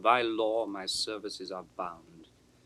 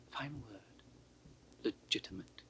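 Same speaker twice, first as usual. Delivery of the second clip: a mocking whisper alone on stage; scornful whisper; 12 dB quieter than usual. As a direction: hiss it in a sneering whisper. Edmund mocks the word.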